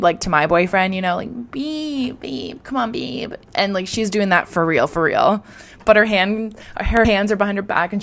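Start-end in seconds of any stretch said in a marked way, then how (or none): 1.5-3.3 higher, softer whiny babe; mock wheedling babe, higher and softer; annoying whine. Shown as a demonstration. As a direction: nag playfully, pitched up and soft. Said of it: "being annoying on purpose".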